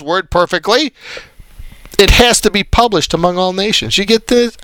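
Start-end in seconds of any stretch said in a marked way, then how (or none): none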